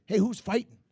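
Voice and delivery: bright, high curious call; eager question. Retold or imitated. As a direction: ask it eagerly. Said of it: "and I was like".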